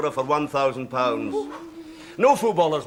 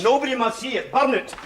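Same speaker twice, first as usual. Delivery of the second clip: alarmed order; 8 semitones higher than usual. Acted. Butler urgently orders scandal burnt.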